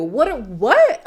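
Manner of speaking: what, what, then bursting out laughing; incredulous giggle; baffled laugh. Real, not acted. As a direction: laugh in disbelief at the question. Confused by Steven's question.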